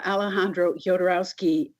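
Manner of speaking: normal talking voice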